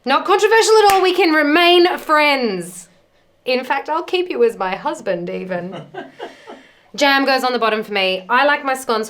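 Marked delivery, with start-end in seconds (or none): 0.0-5.3 excited. Real, not acted. Husband picks jam first.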